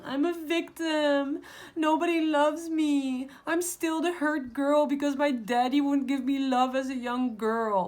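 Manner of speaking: high, loud, slow self-pitying wail; whiny victim voice. Pretend. Mocking the victim mindset to viewers, whiny high wail. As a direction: play the helpless hurt girl. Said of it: "They want you to be thinking".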